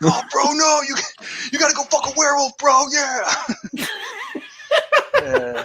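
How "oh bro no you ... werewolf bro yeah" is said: high, fast mock bro voice. Friend urging the hero, hammed-up bro voice. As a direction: push him like a dumb frat bro. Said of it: "And like".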